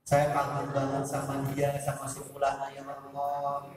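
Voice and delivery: a student's humble, hushed prayer; earnest prayer. Pretend. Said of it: "kalau kalian doa".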